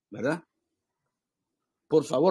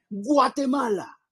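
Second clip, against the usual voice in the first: declaimed; 8 semitones higher, much slower than usual. Shown as a demonstration. Preacher showing the congregation how to declaim the anthem, grandly. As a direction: declaim "Guatemala... feliz" with grand pauses, rising each time. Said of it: "si usted lo quisiera declamar".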